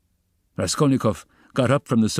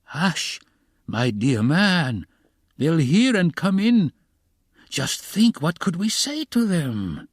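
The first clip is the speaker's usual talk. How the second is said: high, drawn-out urgent alarm; high, pleading dismay; horrified hush; 10 semitones higher, much slower than usual. A narrator's reading. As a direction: hush them in horrified panic. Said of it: "whispered in horror".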